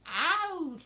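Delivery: badly faked Northern "ow"; attempted Northern accent. Shown as a demonstration. Trying to get into the mood for it, shaky Northern accent. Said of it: "able to do a Northern accent".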